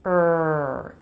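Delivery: "Er" held as one sound; "Er" with the R absorbing the vowel; R-coloured schwa. Shown as a demonstration. Teacher isolating the sound in "Er". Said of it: "it absorbs it".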